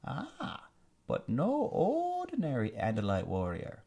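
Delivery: high, slow sneering alien villain. Acted. Alien commander gloating over captured prince, high sneering villain voice. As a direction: savor every word like a gloating tyrant. Visser voice.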